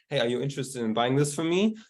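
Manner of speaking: normal talking voice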